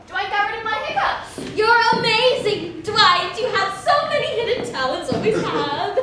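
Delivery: bubbling over with joy; delighted. Acted. Delighted praise after hiccups cured.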